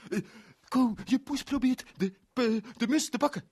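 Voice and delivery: urgent alarm to his friend; Anton alarmed. Acted. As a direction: panicky friend. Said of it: "zei hij".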